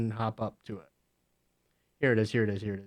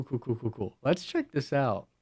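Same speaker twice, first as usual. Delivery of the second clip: normal talking voice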